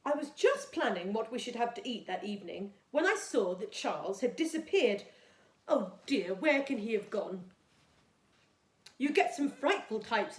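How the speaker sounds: put-on storytime reading for young children; gentle storybook read-aloud for Year two; reading a story. A narrator's reading. Teacher reads story aloud to class.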